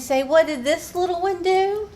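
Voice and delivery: high sing-song doggy-mama voice; baby talk to pet. Pretend. Owner speaks for her dog on camera, high baby talk.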